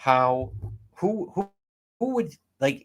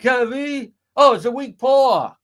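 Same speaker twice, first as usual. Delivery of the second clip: high, loud mock Fall River voice; mocking Fall River accent; 10 semitones higher, 9 dB louder than usual. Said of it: "Yeah, the accent".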